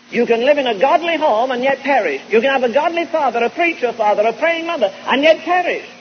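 high, loud pulpit warning; rising sermon fervor; fervent preaching. Acted. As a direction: thunder it at the congregation.

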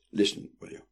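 urgent hushed whisper. A narrator's reading. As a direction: hiss it under your breath. Sister hissing at brother.

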